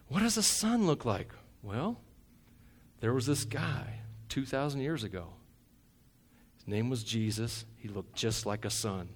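usual speaking voice

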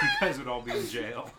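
words squeezed out through laughter; laughing. Real, not acted. Joking to friends while laughing, high and laughing. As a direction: talk while cracking up.

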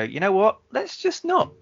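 high, breezy let's not; casually bail out; giving up. Acted. Characters giving up, voiced.

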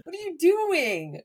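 high, stretched-out mock outrage with laughter; mock angry. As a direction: imitate an exasperated scolding, dragging out the words. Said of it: "he got so mad at me".

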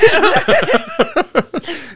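high cackling laugh; real laughter. Real, not acted. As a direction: crack up mid story. Laughing mid imitation.

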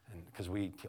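ordinary delivery

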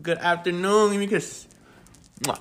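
gentle coaxing. Real, not acted. Coaxing daughter to greet listeners.

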